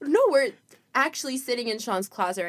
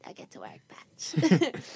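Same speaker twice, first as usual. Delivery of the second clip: soft unvoiced echo of the line; whispered; 3 semitones higher, 4 dB quieter than usual. Shown as a demonstration. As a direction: whisper it back quietly.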